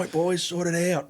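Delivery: high, slow command to fight; RSM ordering. Retold or imitated. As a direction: send the boys in.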